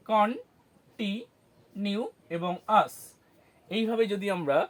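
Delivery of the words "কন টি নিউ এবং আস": কন, টি, নিউ, আস as separate slow pieces; syllable by syllable. Shown as a demonstration. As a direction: count out কন... টি... নিউ এবং আস with pauses. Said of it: "সিলেবেলে কীভাবে ভাগ করব". (কন-টি-নিউ-আস, syllable ভেঙে থেমে থেমে)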